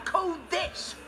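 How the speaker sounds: high-pitched, indignant comeback; fed-up, defiant outburst; irritated retort. Acted. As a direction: throw it back at the caller. Angry retort to prank caller.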